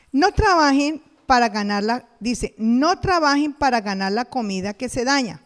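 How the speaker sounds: loud, measured Bible reading; scripture reading. Acted. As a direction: proclaim the verse to the congregation.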